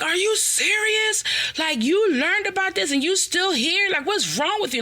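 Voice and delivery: high, shocked mock outrage; incredulous. Scolding her sister, incredulous high outrage.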